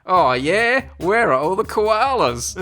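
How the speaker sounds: a hammed-up Australian yelling out; Australian accent shout. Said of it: "with the thickest Australian accent, they shout".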